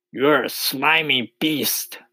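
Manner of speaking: high, slow taunting insult; taunting centipede. A narrator's reading. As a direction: jeer as a storybook centipede. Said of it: "answered the centipede".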